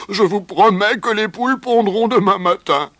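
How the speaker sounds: high, loud desperate promise; pleading. A narrator's reading. Rooster promises the owner eggs by morning, pleading. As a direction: beg to be believed. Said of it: "supplia-t-il".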